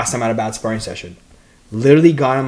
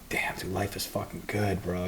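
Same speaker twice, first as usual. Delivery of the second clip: softer, hushed musing to himself; quiet inner voice; 3 semitones lower, 11 dB quieter than usual. Retold or imitated. Fighter recalls thinking over morning coffee, softer, hushed. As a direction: murmur it like a private thought of contentment. Inner voice savoring a good life.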